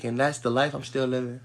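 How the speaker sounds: ordinary talk, nothing marked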